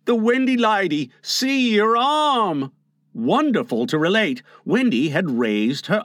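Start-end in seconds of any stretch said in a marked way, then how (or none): 0.0-2.7 high, amazed cry, slowing at the end; excited cry. Acted. Nibs shows the others Wendy moved, amazed cry. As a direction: point it out, drawing out the last words. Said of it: "he cried".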